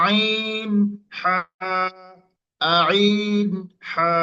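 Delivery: আইন pressed harder in the middle of the throat. Shown as a demonstration. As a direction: squeeze আইন tighter, then হা. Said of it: "আরেকটু চাপ দিবেন".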